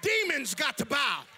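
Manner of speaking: loud, high shouting sermon; full force preaching. Acted. Preacher shouting to the congregation about Jesus. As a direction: whip up the congregation.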